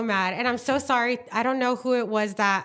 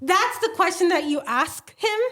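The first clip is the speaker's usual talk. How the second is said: frustrated rant; 4 semitones higher than usual. Retold or imitated. Retelling frustration at Q and A.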